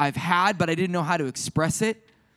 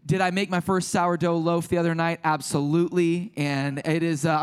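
normal talking voice

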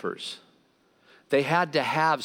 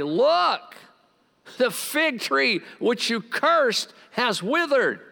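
much higher, slow, amazed disciple; Peter amazed; 11 semitones higher, much slower than usual. Acted. Peter telling Jesus the fig tree withered, high and amazed. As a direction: Peter pointing at the tree in wonder. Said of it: "Peter said to him".

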